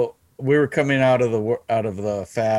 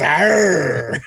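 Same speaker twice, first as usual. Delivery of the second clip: pirate growl; 4 semitones higher than usual. Retold or imitated. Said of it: "he looked at me and goes".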